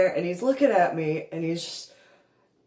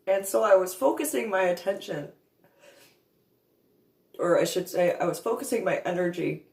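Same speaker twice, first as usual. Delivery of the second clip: normal talking voice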